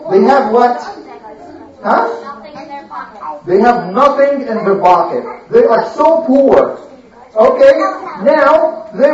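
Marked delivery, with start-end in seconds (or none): none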